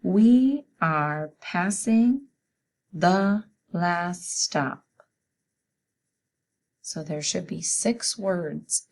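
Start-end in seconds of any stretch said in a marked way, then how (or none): 0.0-4.9 slow, loud, word-by-word dictation. Shown as a demonstration. Teacher dictating a sentence to students, slow and loud. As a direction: dictate each word slowly to the class. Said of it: "Say it with me".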